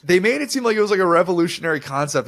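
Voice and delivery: exaggerated disbelief at the hype; incredulous. Real, not acted. Mocking broadcast hype.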